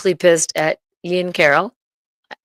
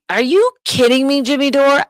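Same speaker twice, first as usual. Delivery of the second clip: raised, exasperated yelling at him; angry outburst; 6 semitones higher, 6 dB louder than usual. Real, not acted. Ranting at her ex-boyfriend for not inviting her, angry yell. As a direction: call him out, louder and higher.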